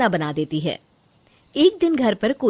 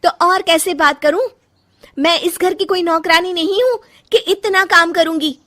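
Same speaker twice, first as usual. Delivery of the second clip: high, loud indignant outburst; fed-up daughter-in-law refusing to serve; angry retort; 10 semitones higher, 6 dB louder than usual. Acted. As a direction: fire back at the mother-in-law. Daughter-in-law quarrels back.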